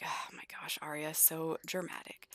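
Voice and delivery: whispered eye-roll, barely voiced; mocking whisper. [name] whispers about his dramatic girlfriend to the dog. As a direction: hush it like a sneaky aside.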